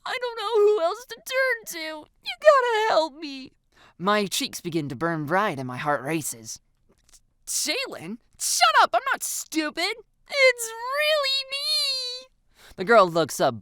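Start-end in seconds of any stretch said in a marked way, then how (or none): 0.0-3.5 high, thin begging voice; desperate plea. A narrator's reading. Girl on her knees to friend, begging. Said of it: "before dropping to her knees". 7.5-12.3 put-on high, whiny girlish protest; desperate insistence. A narrator's reading. Girl convincing doubting friend, desperate.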